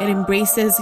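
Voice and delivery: normal talking voice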